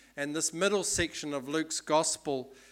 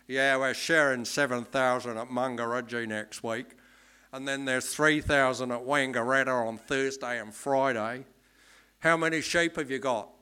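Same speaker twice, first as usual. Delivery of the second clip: broad, louder Australian shearer voice, brisk and busy; Australian accent; 6 dB louder than usual. Retold or imitated. Shearing contractor on the phone to the lifestyler, loud Australian accent. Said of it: "this is my best Australian accent".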